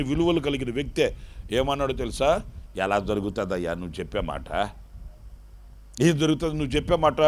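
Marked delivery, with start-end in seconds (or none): 2.8-4.7 low, soft scoffing question; doubting old priest. Retold or imitated. Old priest doubting the angel, low and scoffing. 6.0-7.2 fast, dismissive retort; rushed doubting. Retold or imitated. Priest scoffing at the angel, fast and dismissive.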